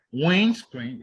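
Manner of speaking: windscreen with WIND loud and long; stress on first word. Shown as a demonstration. Teacher listing compounds, windscreen stressed on WIND. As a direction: punch WIND, let screen drop. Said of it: "on the first syllable".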